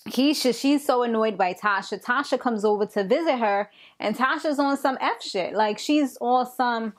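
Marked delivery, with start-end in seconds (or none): none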